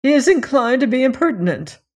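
prim, stern complaint; disapproving. A narrator's reading. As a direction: pronounce judgement with lofty disdain. Stern lady passes judgement on boy.

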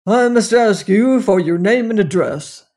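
gruff bobby demanding details; london policeman. A narrator's reading. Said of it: "said the constable gruffly".

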